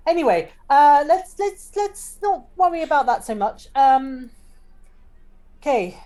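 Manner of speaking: high, stammering, hurried change of subject; flustered. Acted. Cleegee steers the party off the clipboard, flustered. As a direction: trip over repeated words, flustered. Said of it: "Cleegee's obviously very flustered now".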